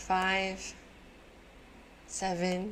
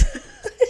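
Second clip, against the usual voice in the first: high laugh; 8 dB louder than usual. Real, not acted. Laughs at find.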